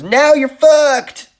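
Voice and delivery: high, loud, slow punchline yell; triumphant shout. Acted. Fisherman hurling the lady off the dock, triumphant shout. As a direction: bellow it as you throw her off.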